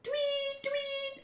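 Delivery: very high, soft "twee"; thin high-pitched tweeting; imitated whistle. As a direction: squeak out whistle blasts.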